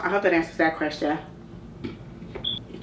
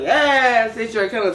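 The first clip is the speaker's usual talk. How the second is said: hyped self-praise; 4 semitones higher, 4 dB louder, slower than usual. Retold or imitated. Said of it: "Sometimes I'm like".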